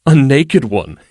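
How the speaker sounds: light quip. A narrator's reading. Danilo quipping to deflect Erlin's challenge, lightly. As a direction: toss off the joke lightly. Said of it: "he quipped lightly".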